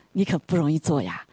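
usual speaking voice

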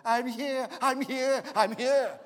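put-on high, loud little-boy cry from hiding; a small boy shouting to be found, high and loud; child calling out. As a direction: call out like a lost kid stuck in the rack. Lost child calls from dresses.

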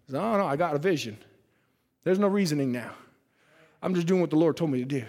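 calm husband. Acted. Amram answers calmly.

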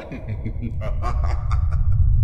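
loud unvoiced snicker; loud airy sneering laugh; breathy mocking laugh. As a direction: laugh at them like a villain. Trickster mocks the children.

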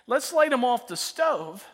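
slow, loud, eager pitch of a dumb plan; hammed-up excited teenager; eager teen idea. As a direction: sell it like a genius idea. Teen self pitching a bad idea.

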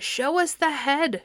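high, slow, insistent crowd chant. A narrator's reading. Crowd chanting at Perseus for the head, slow and high. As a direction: draw the demand out like a chant. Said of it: "shouted the people".

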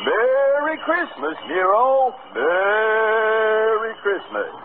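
high, slow, drawn-out Merry Christmas; sing-song jolly Santa voice; Santa's greeting. Acted. Santa greeting the emperor Nero, high and slow.

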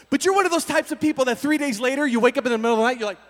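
ordinary talk, nothing marked

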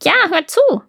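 high crow voice; raspy cawing crow. Acted. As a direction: call it out like a crow. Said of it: "sagte die Krähe".

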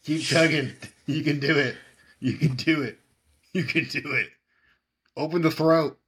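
high excited chanting; frat bro. Real, not acted. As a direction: hype up a friend like a coach. Cheering the chug.

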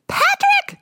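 very high piercing yell of a name; screech. A narrator's reading. Furious mom screeching for her husband Patrick. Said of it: "she screeched".

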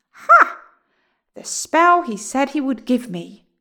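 high, loud triumphant witch; greedy witch. A narrator's reading. Witch pounces greedily on package.

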